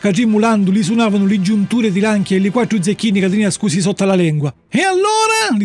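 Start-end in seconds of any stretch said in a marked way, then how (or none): 4.7-5.6 much higher, sneering demand; snarling thug voice; menacing assassin. Acted. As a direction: threaten the puppet. Assassins threaten Pinocchio.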